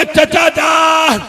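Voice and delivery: very slow, high, loud wailing cry; crying call for help. Acted. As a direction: wail for your uncle as you fall. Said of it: "जनाब कासिम ने रोके कहा".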